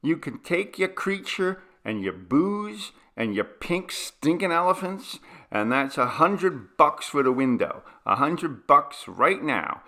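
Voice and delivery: loud, high furious threats; angry landlord ordering the tenant out; angry demand. Acted. Landlord demands money, threatens police.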